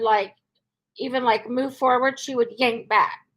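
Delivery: ordinary talk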